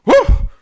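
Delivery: very high, loud wild yelp; whooping yelp going bananas. Acted. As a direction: let out a crazed whoop. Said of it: "yelps a few times".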